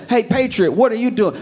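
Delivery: high, chirpy reporter voice; a bright, high mock interview; mock interviewer. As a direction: ask it like an eager interviewer. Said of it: "It's like".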